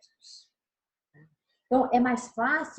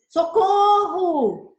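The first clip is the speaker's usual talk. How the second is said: much higher, much louder, very slow desperate shout; real cry for help; 8 semitones higher, 9 dB louder, much slower than usual. Shown as a demonstration. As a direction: scream "Socorro!" as if truly in danger. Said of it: "Olha o que eu uso de entonação".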